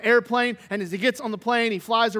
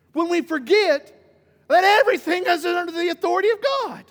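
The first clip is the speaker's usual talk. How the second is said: preaching; 7 semitones higher than usual. Acted. Preacher rises to full force.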